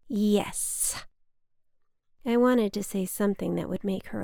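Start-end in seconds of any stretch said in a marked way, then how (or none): 0.1-1.1 heavy drawn-out yes. Acted. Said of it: "a heavy, drawn-out".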